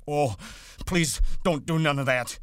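high, loud wailing plea; begging in fright. A narrator's reading. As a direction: beg as if crying with fright. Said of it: "he begged".

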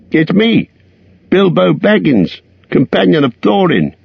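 high call to be found; crying out. A narrator's reading. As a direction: call out eagerly. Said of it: "he cried".

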